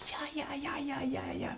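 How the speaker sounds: breathy whispered cheer, high; whispered yeah. Real, not acted. Whispered celebration after reveal.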